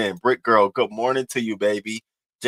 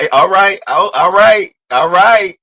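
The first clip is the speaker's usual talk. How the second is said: excited; 12 semitones higher, 9 dB louder, slower than usual. Real, not acted. Excited laughing at chat.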